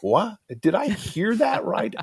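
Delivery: retold high-pitched, incredulous reaction; sleepy disbelief. Sleepy listener doubts what he heard at the devotion, incredulous. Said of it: "I'm still kinda sleepy. I'm like".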